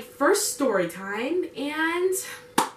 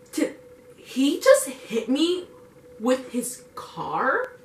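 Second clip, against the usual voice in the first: very slow, drawn-out incredulous question; stunned disbelief; much slower than usual. Retold or imitated. Passenger just tapped by her driver's car, stunned disbelief. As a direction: stretch each word in retold shock. Retold shock at being hit.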